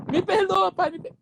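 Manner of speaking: put-on high, tearful little boy begging; boy pleading with his father not to punish him; child begging. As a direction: beg for forgiveness like a scared child.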